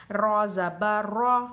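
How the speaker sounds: slow, full heavy रॉ with zabar. Shown as a demonstration. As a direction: stretch out रॉ जबर रॉ, thick and full. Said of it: "पुर का मतलब आवाज़ मोटी होनी चाहिए". (मोटी आवाज़ में रॉ)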